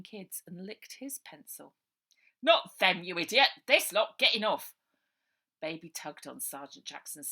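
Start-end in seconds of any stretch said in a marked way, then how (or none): 2.4-4.7 loud, impatient telling-off; exasperated shout. Acted. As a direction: yell at the dim constable. Sergeant scolding constable.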